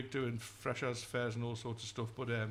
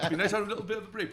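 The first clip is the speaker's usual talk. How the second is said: laughing; 6 semitones higher, 4 dB louder, faster than usual. Real, not acted. Laughing through the line.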